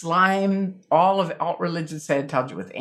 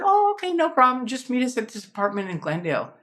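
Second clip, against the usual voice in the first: imitating Bill, jumping much higher on the first words; a falsely easy, much higher Oh okay, then settling; mock breezy Bill; 9 semitones higher than usual. Retelling how friend Bill brushed off her quitting, mock breezy and much higher. Bill's reply, acted in retelling.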